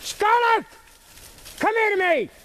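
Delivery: high hollering call; yelling from the sidelines. Real, not acted. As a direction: holler across the field for the dog. Hollering for a distant dog.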